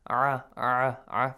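"Ah" squeezed in the throat; short repeated "Ah" made in the throat; throaty ayn sound. Shown as a demonstration. Teacher isolating the letter, "Ah" as a throaty ayn. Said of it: "from the top part of your throat".

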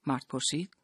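ordinary delivery

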